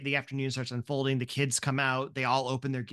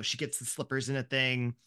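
ordinary talk

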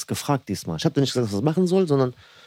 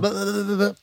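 higher, mocking blablabla; patzig nachgeäfft; 7 semitones higher, slower than usual. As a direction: imitate a snippy colleague with raised pitch. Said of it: "auch mit erhobenem Ton". (patzig, mit erhobenem Ton nachgeäfft)

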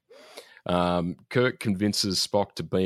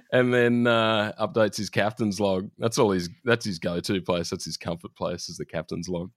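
ordinary talk, nothing marked

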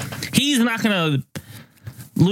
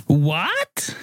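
rising "what" in a put-on Japanese accent; mock Japanese accent. Storyteller voices the girl in the tale, mock Japanese accent. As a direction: imitate a Japanese girl's questioning reply. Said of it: "Is that your Japanese accent?".

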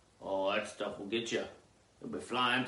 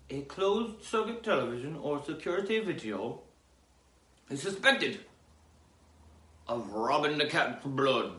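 news read; 5 semitones higher, 4 dB louder than usual. Acted. Reading the crime report.

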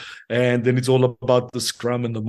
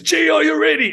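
very high, loud yelled question; coach shouting; 4 dB louder than usual. Retold or imitated. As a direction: bellow it like a pumped coach before kickoff. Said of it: "he just lifts up the tone of his voice".

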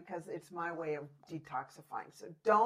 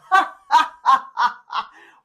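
big loud forced laugh, high and bright; demo laugh; 6 semitones higher, 19 dB louder than usual. Shown as a demonstration. Host demonstrating a laugh, big forced laugh. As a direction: belly laugh on cue for the listeners. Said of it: "encourage you to just go".

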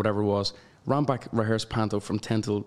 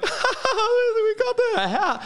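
much higher, very slow, choked with laughter; laughing through tears; much slower than usual. Retold or imitated. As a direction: squeeze out the words while laughing and crying. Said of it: "laughing hysterical, but also bawling".

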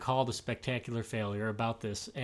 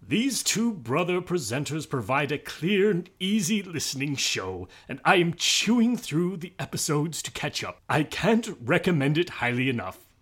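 hammed-up British accent, higher and louder; horrible British accent; 3 semitones higher, 5 dB louder than usual. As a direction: read it out in an exaggerated, bad British accent. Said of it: "reading in a horrible British accent".

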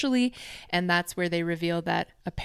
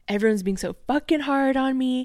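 mock whine, a bit higher; whining; 6 semitones higher, 4 dB louder than usual. Podcaster retelling a man's complaints, mock whine. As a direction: mildly imitate his self-pitying gripe. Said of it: "whinging here, whining".

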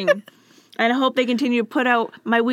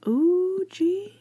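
whispered ooh; 9 semitones higher than usual. Pretend. Teasing reaction to long email.

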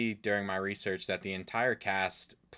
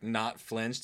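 ordinary talk

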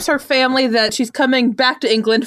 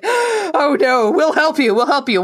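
hammed-up worried parents; 6 semitones higher than usual. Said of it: "her parents are like".

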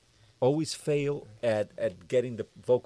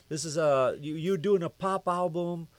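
higher-pitched imitation of an indignant boss; retold angry yell; 7 semitones higher than usual. Label president berating the producer over the album, mock angry yell. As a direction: scold in a raised pitch, mock yelling. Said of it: "he's yelling at me".

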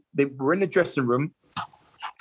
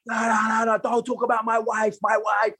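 higher-pitched mock outrage; retold angry outburst; 5 semitones higher than usual. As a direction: act out a teammate losing it over his wife. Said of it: "Miguel's just lost it".

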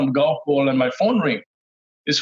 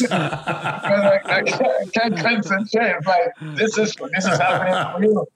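higher, giddy laugh-talk; nervous excitement; 5 semitones higher than usual. Real, not acted. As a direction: still buzzing from the call.

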